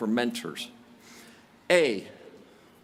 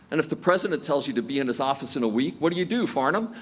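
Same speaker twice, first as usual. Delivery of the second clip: ordinary talk, nothing marked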